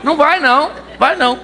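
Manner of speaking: high, hammed-up nagging wife; bossy wife. Wife forbids her converted husband to go evangelize, high hammed-up scolding. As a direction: forbid him sharply like a controlling spouse.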